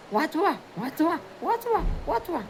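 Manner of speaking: high, thin panicky call for help; cry for help. Acted. Drowning boy calls for help, high and panicked.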